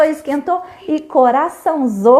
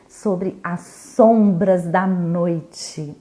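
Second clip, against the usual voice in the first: slow title reading; 5 semitones lower, much slower than usual. A narrator's reading. Announcing the story title.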